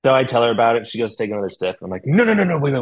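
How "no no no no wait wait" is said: excited interrupting. Retold or imitated. Stopping wife again, excited.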